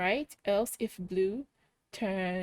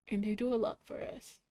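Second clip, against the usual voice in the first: ordinary delivery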